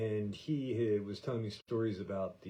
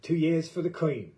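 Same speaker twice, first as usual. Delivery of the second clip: mock Cockney soldier, higher and louder; imitating the Cockney guy; 6 semitones higher, 8 dB louder than usual. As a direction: quote the young Brit with punch. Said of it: "he said".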